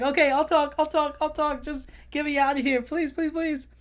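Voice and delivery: high begging crab; panicked crab. Retold or imitated. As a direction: plead frantically to be let down. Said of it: "And he's just like".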